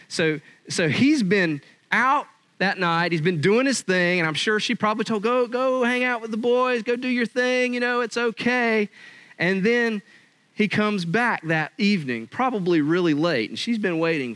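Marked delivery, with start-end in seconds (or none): 5.2-8.9 mock wife's voice. Wife sending husband out, acted.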